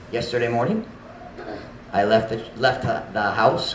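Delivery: normal talking voice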